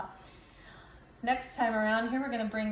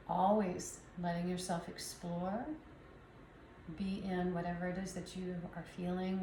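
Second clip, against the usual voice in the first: calm them into letting go; guided meditation; 3 semitones lower, 7 dB quieter than usual. Acted. Soft guided relaxation cue.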